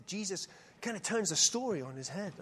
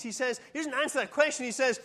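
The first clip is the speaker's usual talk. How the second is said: usual speaking voice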